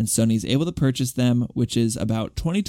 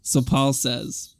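usual speaking voice

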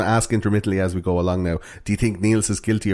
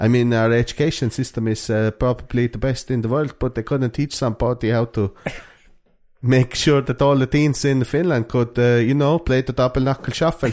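put-on higher, halting Finnish accent; 4 semitones higher than usual. Host as Finnish teen faulting the schools, comic Finnish accent. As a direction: protest the unfairness in a Finnish accent. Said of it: "That's a pretty good Finnish accent".

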